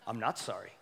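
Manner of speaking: put-on low, soft, stubborn kid mutter; sullen child. A refusing child, low and soft to the parents.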